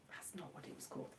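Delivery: whispered quick correction; whispered aside. Real, not acted. Correcting herself to viewers, whispered aside. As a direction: breathe it fast under your breath.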